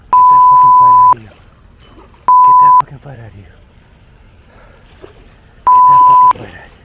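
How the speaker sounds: high, fast, all-out scream. Real, not acted. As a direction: scream at the fish to quit. Yelling at the hooked fish.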